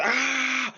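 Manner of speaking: high, loud mock scream; hammed-up squealed battle cry; shrimp's scream. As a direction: scream like a tiny cartoon shrimp. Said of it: "the shrimp's going".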